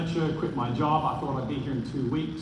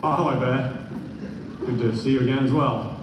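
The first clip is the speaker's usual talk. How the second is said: louder, hammed-up macho tone; mock manly nervous greeting; 6 dB louder than usual. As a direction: play it like two tough guys emailing. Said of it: "So nervous".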